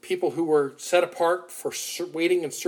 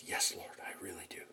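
faint, lower whisper, almost gone; broken whisper; 10 semitones lower, 18 dB quieter than usual. Retold or imitated. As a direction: barely get the words out. Peter's third answer, broken.

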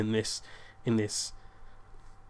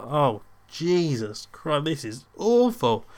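slow, drawn-out groaning dismay, raised pitch; mock despair; 4 semitones higher, 4 dB louder, much slower than usual. Son realising his lie has collapsed, slow mock despair. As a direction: stretch out the horror, louder at the end.

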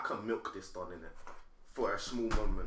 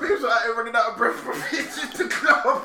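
higher and louder, through laughter; laughing while talking; 7 semitones higher, 7 dB louder than usual. Real, not acted. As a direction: giggle out the joke. Telling a joke while laughing.

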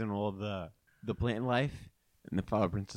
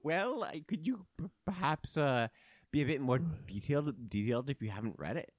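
high, prim princess voice; prissy fairy princess asking sweetly; theme park princess; 5 semitones higher than usual. Acted. Flower Princess keeps questioning.

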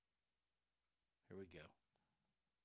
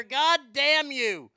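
mock outrage; 10 semitones higher, 11 dB louder than usual. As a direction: yell it like you've been tricked. Host reacts to an [name] song prank.

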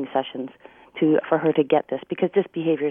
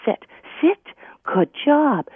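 cheerful dog command; 9 semitones higher, 4 dB louder, slower than usual. Shown as a demonstration.

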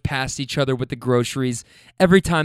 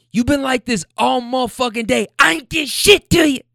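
high, loud mock top-of-lungs yell; retold shriek of complaint; imitating her screaming; 10 semitones higher, 4 dB louder than usual. Woman screaming at her boyfriend in the street, high yell. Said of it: "at the top of her lungs".

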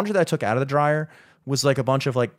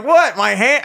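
high, loud retold "what?"; startled confusion; 6 semitones higher, 6 dB louder, slower than usual. Customer to clerk pointing at his hand, startled confusion. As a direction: act out being caught off guard.

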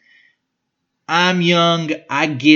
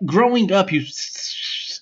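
usual speaking voice

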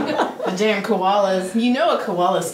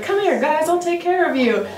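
lightly voiced, higher-pitched coaxing; Steve Irwin; 7 semitones higher than usual. Meme's zookeeper welcoming dead animals, lightly voiced coaxing. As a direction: call the animals over, pitched up. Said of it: "and he's like".

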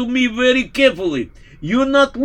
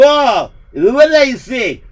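normal talking voice

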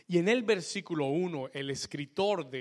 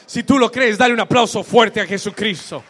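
full-force preaching; 6 semitones higher, 19 dB louder than usual. Real, not acted.

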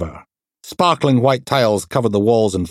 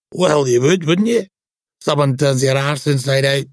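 higher, rough-humoured detective; raised-pitch voice for the crude inspector Steele; brash inspector voice; 5 semitones higher than usual. Acted. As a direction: play the crude, cheeky DI. Steele's voice in story.

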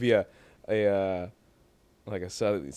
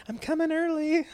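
mock high voice. Mocking how she'd never talk.

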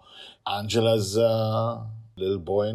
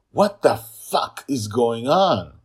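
loud, slow furious demand; angry demand; 7 semitones higher, 12 dB louder, slower than usual. Acted. Sister confronting her brother, loud angry demand. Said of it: "Angela demands from Justin".

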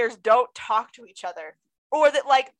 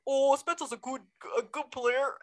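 failed Babcock accent attempt; 6 dB quieter than usual. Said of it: "I'm trying to do his accent".

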